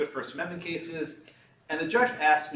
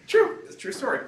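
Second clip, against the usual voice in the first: laughing; 5 dB louder than usual. Real, not acted. Laughing insistence on truth.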